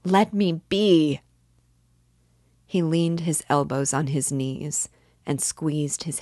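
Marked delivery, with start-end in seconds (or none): 0.0-1.2 loud, high, drawn-out cry of agony; agonized cry. A narrator's reading. Tormented man crying out to Sonia again, in agony. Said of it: "in a sudden spasm of agony".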